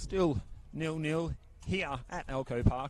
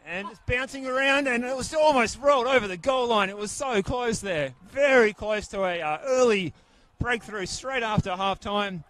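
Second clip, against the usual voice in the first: rising, louder near-miss call; excited commentary; 5 semitones higher, 6 dB louder than usual. Real, not acted. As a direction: call the near goal with a jump in energy. Near goal in live commentary.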